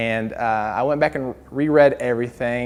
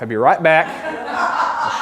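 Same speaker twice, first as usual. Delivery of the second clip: laughing. Retold or imitated. Laughing through his own threat.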